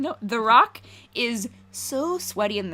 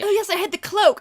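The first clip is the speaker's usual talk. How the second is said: high, half-hearted Irish lilt that drifts British; a mock Irish accent that barely lands; imitating Michael Fassbender in a weak Irish accent; bad Irish accent; 10 semitones higher, 4 dB louder than usual. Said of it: "I'm a terrible Irish accent, so I'm not even gonna try it, but I'm gonna pretend".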